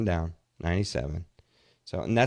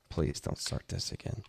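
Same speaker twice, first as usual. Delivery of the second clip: whispered plea; 15 dB quieter than usual. Real, not acted. Pleading with a friend named Dave.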